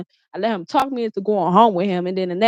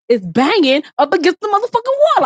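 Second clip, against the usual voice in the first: much higher, heated retelling; upset emphasis; 10 semitones higher, 3 dB louder than usual. Real, not acted. Recalling her head hitting the wall, high heated telling. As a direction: push it up, reliving the pain.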